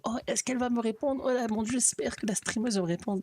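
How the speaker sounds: mock-excited high-pitched fangirl voice; eagerly waiting fan. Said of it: "puis qui attend comme".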